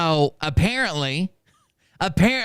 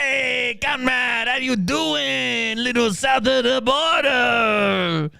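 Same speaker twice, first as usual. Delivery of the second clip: high, slow, hammed-up Mexican greeting; mock Mexican accent; 9 semitones higher, slower than usual. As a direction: mimic a botched Russian accent that sounds Mexican. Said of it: "What accent is that?".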